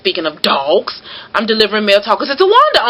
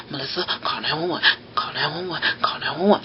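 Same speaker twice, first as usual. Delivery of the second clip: hushed, hurried, softer, urgent under her breath; urgent hushed plea; 5 semitones lower, 9 dB quieter, faster than usual. Retold or imitated. Mail carrier urging friend on phone near a dog, hushed and urgent. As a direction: keep it low so the dog won't startle. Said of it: "not screaming 'cause I don't wanna startle the dog".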